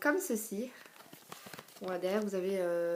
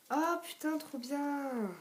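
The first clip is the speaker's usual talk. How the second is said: high, drawn-out wow; real delighted gushing; thrilled admiration; 6 semitones higher, much slower than usual. Real, not acted. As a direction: let it float up high in delight. Opens the sample and admires it.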